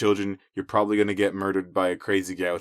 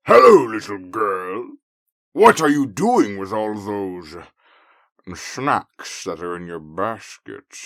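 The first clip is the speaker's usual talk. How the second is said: loud, slow wolf voice booming a greeting; a looming wolf sizing up his prey; loud predatory wolf, drawn out; big bad wolf; 7 dB louder, slower than usual. Wolf greets Red loudly.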